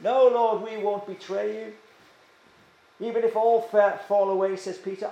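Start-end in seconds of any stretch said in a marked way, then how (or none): 0.0-1.7 loud, higher-pitched self-confident protest; boastful. Acted. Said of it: "they were boasting". 3.0-4.6 raised, self-confident declaration; boastful. Acted. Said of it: "they were boasting".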